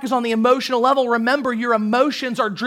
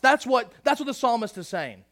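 ordinary talk

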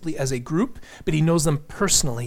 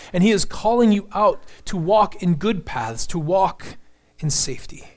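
higher and louder, pressing each "knows"; preaching at full force; 4 semitones higher, 3 dB louder than usual. Acted. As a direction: drive it home to the congregation, rising. Urgent preaching.

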